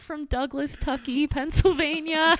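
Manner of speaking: a hint of hammed-up underdog; mock sob story. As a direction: play up the poor-little-me pitch, stretching the last words. Pitching a hard-luck backstory for himself.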